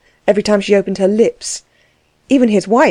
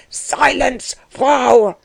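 raised-pitch roar of command; higher, forceful husband's bellow; 6 semitones higher, much slower than usual. A narrator's reading. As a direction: cut in with an angry roar. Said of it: "roared Delvig".

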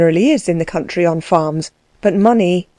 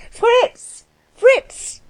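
calling out. Acted. Calling the coachman again.

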